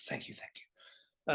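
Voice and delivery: soft awed whisper to a star; whispered thanks. Retold or imitated. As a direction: barely voice it, starstruck. Awestruck thanks to a film star.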